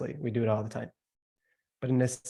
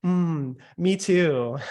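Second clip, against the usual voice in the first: higher, louder gushing "me too"; rising, overkeen listener noises; hammed-up eager salesperson; mock eager agreement; 4 semitones higher, 5 dB louder, slower than usual. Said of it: "where it's like".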